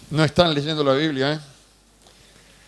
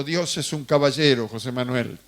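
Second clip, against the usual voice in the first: lower, calm reassuring voice; gentle pastor; 7 semitones lower than usual. Retold or imitated. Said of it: "apareció un pastor que me dijo". (pastor sereno y tranquilizador, voz más grave)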